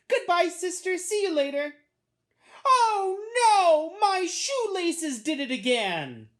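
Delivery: put-on high, loud little-boy cry of dismay; dismayed little brother. A narrator's reading. Boy leaving as laces come undone, loud dismay. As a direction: call out high, then wail in frustration.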